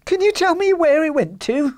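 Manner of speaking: very high, eager plea; desperate cry. Acted. Little man begging for the goose's whereabouts, desperate. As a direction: beg for it, overcome with emotion. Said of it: "he cried".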